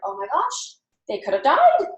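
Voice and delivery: mock alarm. Parent imagining the child could have died, mock alarm. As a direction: build to a mildly horrified exclamation. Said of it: "you start feeling terrible".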